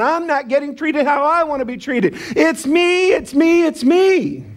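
high, mock tantrum of a needy customer; self-centred whining. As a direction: whine for attention, pitched high and insistent. Acts out me-first customer's tantrum.

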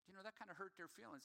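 a softly spoken wife pointing out a hurt; gentle hushed wife. Retold or imitated. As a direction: break it to him gently and softly. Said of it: "Jamie will be like".

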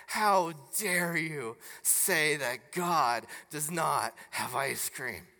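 slow, low, scandalized delivery; mock outrage at heresy. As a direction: imitate solemn horror, drawing the words out. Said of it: "as if I had just committed heresy".

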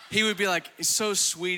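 ordinary delivery

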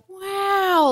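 put-on high, loud child's wow; amazed child. Nephew seeing crystals for the first time, high amazed wow. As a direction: gasp it out in wonder like a small boy.

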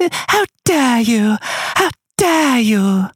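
high, slow mock outrage; Greta Thunberg impression. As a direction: drag out each word, trembling indignant. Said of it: "Climate girl".

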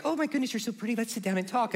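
soft, sweet imitation of mom; gushing mom voice. Mom meeting son's girlfriend, gushing mom impression. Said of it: "is just real like".

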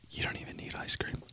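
hushed secret aside; conspiratorial whisper. Real, not acted. Whispered aside about pie.

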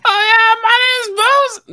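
very high, loud falsetto mimicry; imitating squeaky voice. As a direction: parrot back a shrill high voice. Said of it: "that's my voice that you're doing".

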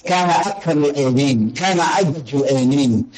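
ordinary talk, nothing marked

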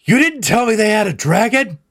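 high, loud startled cry; shocked outburst. Acted. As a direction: yelp it in disbelief. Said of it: "he's like".

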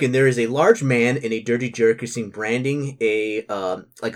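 normal talking voice

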